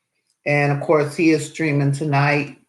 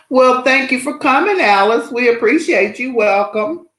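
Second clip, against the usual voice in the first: excited welcome; 7 semitones higher, 4 dB louder than usual. Real, not acted. Greeting a new viewer.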